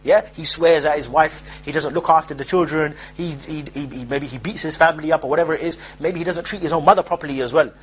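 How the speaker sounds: usual speaking voice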